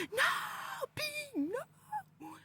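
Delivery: high, scared cries of no; a panicked high-pitched plea to the girl; terrified pleading. Retold or imitated. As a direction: beg the lunging child to stop. Little girl lunges at her.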